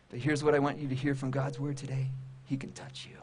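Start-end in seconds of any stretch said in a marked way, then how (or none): 2.4-3.2 tender whispered promise. Real, not acted. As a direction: drop to a near whisper. Intimate assurance to the listeners.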